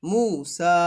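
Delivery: "মূসা" with both vowels drawn out; stretched recitation-style "মূসা"; long-vowel recitation. Shown as a demonstration. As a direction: hold the oo, then the aa in "মূসা". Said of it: "খাড়া জবর হিসেবে পড়ে ফেলবেন".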